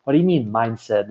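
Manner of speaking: normal talking voice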